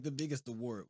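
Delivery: ordinary talk, nothing marked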